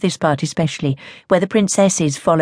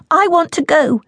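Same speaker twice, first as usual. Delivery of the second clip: put-on high, pushy girl's demand; insistent child; 12 semitones higher, 3 dB louder than usual. Child pleading to leave.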